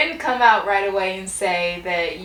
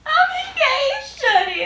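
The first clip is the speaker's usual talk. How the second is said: excited squeal; 3 dB louder than usual. Retold or imitated. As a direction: squeal the news with joy. Retelling her reaction to the proposal.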